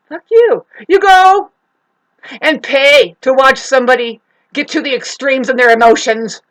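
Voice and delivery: high, loud angry retort; confrontational outburst. Acted. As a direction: hurl it at them, loud and heated. Confrontational outburst at imagined listeners.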